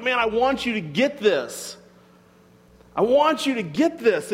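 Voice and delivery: acted-out earnest frustration; frustrated urging. Jesus wanting followers to get it, frustrated. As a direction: press the point with mild exasperation. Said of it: "He's frustrated because he's like".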